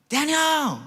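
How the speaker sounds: high holler of a name; calling out. Retold or imitated. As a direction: call out to get his attention. Salesman calling him again.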